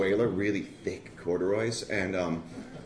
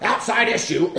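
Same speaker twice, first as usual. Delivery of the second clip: high, loud, dismissive ruling; curt old-timer; 12 semitones higher, 9 dB louder than usual. Retold or imitated. Toothless old man cutting off the heroin talk, curt. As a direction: shut him down like a grumpy elder.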